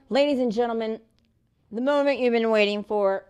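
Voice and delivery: loud show announcer build-up; projected, grand introduction. Acted. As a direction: announce it big to the crowd. Announces the next moment on stage.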